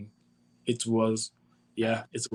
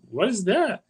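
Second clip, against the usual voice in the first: high surprised question; 9 semitones higher than usual. Retold or imitated. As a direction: ask it in sudden surprise. Retelling own surprised question.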